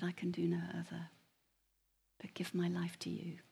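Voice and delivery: breathy hushed surrender, barely voiced; whispered prayer. Kneeling alone on Table Mountain, giving his life in a whisper.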